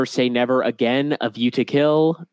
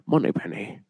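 suave Bond voice; 4 semitones lower, 4 dB quieter than usual. Shown as a demonstration. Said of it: "he calls her by her name".

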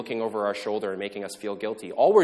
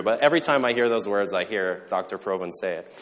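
normal talking voice

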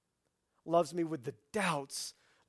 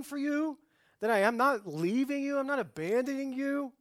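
loud, high-pitched mock rant; hammed-up angry telling-off; exasperated scolding; 11 semitones higher, 8 dB louder than usual. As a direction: scold them as an exasperated Jesus would. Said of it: "if I'm Jesus, I'm not just about".

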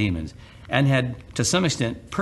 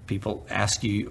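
ordinary delivery

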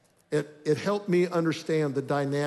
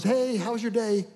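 higher, lightly voiced cheerful wife; wife's breezy greeting; 6 semitones higher than usual. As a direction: ask sweetly, with no edge at all. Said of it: "and she goes".

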